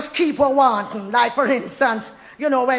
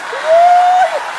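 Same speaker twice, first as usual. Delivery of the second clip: exultant high whoop; 8 dB louder than usual. Real, not acted. Exultant cry.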